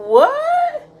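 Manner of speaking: high, loud stunned yelp; shocked what. Retold or imitated. To the guide about the bodies, a high shocked what. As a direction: squeal it in disbelief. Shocked at job description reveal.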